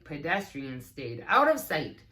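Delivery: ordinary delivery, nothing marked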